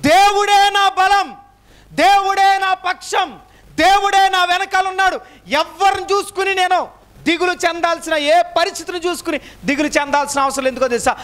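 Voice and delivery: full-force preaching. Acted. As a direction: preach it out, hammering each దేవుడే. Preaching climax on God's strength. (ఉద్రేకంతో గట్టిగా బోధించడం)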